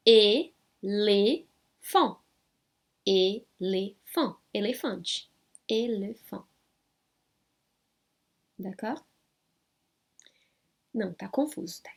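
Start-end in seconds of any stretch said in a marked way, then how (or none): 0.1-6.5 éléphant spelt out syllable by syllable, very slow; very slow demo. Shown as a demonstration. Teacher modelling French for students, very slowly. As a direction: stretch éléphant out for learners.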